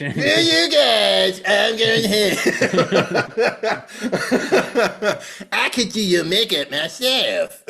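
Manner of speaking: the French slipping into Cartman; mock accent gone wrong; Cartman-ish accent. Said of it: "slipped into this dialect".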